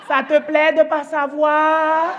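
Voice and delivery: loud, high, very drawn-out taunt; vengeful taunt. Retold or imitated. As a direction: throw the question back, stretching every word. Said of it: "J'ai dit".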